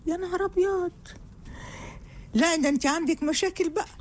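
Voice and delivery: high offended exclamation; mock outrage. [name] offended by the husband's words, high indignant outburst. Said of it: "اخس".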